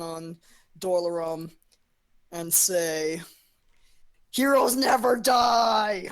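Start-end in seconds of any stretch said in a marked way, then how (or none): 4.3-6.1 slow, loud, high rallying shout; heroic battle cry. Acted. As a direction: proclaim it to inspire a fallen comrade. Bard's inspiring battle cry, acted.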